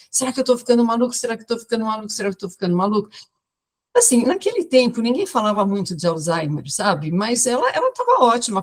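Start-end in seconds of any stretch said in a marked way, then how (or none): 0.1-3.1 fast, repeated three times; anxious rapid repetition. Retold or imitated. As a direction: rattle off the fear three times like the patient. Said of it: "Tudo que ela falava eram três vezes". (repetição rápida e aflita, imitando a paciente)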